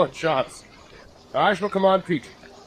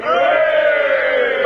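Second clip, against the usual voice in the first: cheering shout; 6 semitones higher, 5 dB louder than usual. Acted. Officer cheers news of capture.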